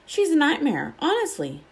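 exasperated Ron. Acted. Ron complaining about Hermione.

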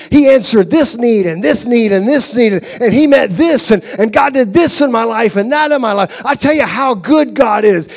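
loud, high, fervent sermon voice; climbing shouted sermon crescendo; full-force preaching. Acted. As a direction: preach it out to the whole room.

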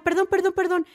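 a light take on tearful apologies; imitating apologizing while crying. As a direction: parody someone sorry for crying. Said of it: "disculparte cuando estás llorando".

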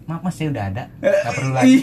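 high, loud, cheeky polite refusal; smug polite voice. Retold or imitated. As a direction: play it smug and sweet. Acting out a trick on the attendant.

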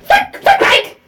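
laughing, exaggerated copy of an accent; mockingly repeating. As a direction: parrot it back in a squeaky mock Cockney. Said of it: "repeating mockingly his accent".

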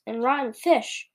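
plain reading voice

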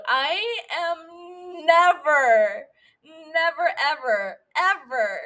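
NEVER drawn out long and high, each repeat punched separately; emphatic. Real, not acted. Podcaster swearing she won't recover, dramatically emphatic. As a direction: stretch "never", then hit each "ever" on its own.

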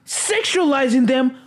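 normal talking voice

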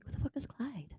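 hushed, disbelieving aside to yourself; mock-confused whisper; incredulous whisper. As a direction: whisper it, baffled and a little rude. Confused reader's inner thought.

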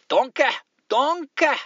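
high, slow Scottish-accent nonsense warm-up. Shown as a demonstration. Host warming up his Scottish accent before a listener's letter. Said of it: "let me see if I can do a Scotla".

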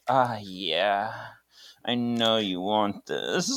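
slow, soft mock-seductive tease; teasing taunt. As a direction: dangle it temptingly, drawing the words out. Teasing cats with a shoelace.

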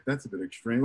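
soft, low-voiced remark under the breath; wife murmuring it quietly; breathy aside. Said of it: "she said on the breath".

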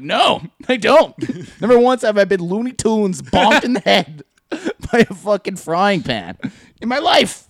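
loud, high, exasperated rant; mock-outraged and emphatic; indignant denial. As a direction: protest the absurdity with full comic outrage. Acted indignant rant at coworker.

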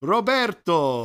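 loud, slow, drawn-out "Roberto"; announcing a name. Real, not acted. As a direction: call it out with relish. Calling out next writer's name.

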